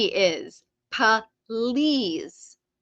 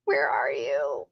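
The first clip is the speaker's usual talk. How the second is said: lower, slow, fake-sob wail; mock crying; 9 semitones lower, 3 dB quieter, slower than usual. Streamer playfully pleading with the missing winner, mock crying. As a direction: pretend to cry for the lost winner.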